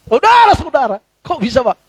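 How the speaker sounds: a touch of a pulpit voice, pitched high; imitating a preacher. Mimicking a pastor preaching to his own family, pulpit-style. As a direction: call out like a pastor to an empty church.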